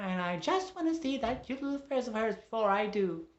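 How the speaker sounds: high, loud caricature of a nagging wife; Missus Wiggles, bossy. Acted. Wife nags husband and orders the visitor, bossy squawk. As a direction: squawk demands without letting up.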